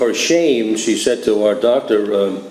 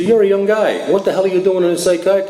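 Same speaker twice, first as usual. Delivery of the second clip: higher, blunt doctor's voice, a bit fast; doctor bluntly grilling a young drinker, higher and quick; 8 semitones higher than usual. Retold or imitated. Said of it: "This is the way he talked to me".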